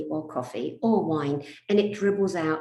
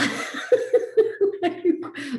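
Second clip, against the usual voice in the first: high bursting cackle; real giggling fit; helpless laugh; 12 semitones higher, 4 dB louder than usual. Real, not acted. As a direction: crack up at your own story. Cracks up at own story.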